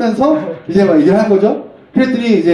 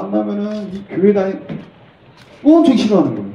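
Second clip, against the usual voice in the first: ordinary talk, nothing marked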